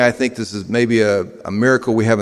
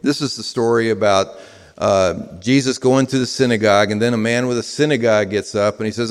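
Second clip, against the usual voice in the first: ordinary talk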